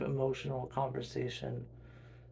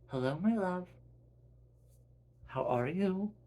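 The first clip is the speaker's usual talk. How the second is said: mom; 6 semitones higher than usual. Acted. Mom answers a telepathic call.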